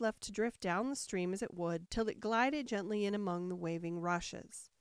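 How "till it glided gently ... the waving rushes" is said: ordinary delivery, nothing marked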